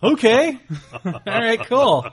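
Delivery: high, laughing all right; laughing okay. Real, not acted. Host laughing along with his co-host, amused. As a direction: giggle out the agreement. Laughing agreement after joke.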